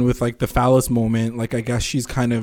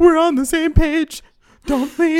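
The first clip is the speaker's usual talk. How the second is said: much higher, slow desperate plea; overdone begging; mock pleading; 14 semitones higher, slower than usual. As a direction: ham it up like a clingy mother. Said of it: "she's like".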